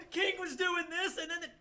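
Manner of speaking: high-pitched gushing play-by-play; breathless fanboy recap; excited rambling. Retold or imitated. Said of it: "my discussion of that chapter would just be like".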